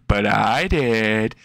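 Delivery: very slow, stretched words; slow, unbothered answer; drawn-out reply. Acted. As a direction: draw each word out at normal pitch. Deadpan reply to the crying guy.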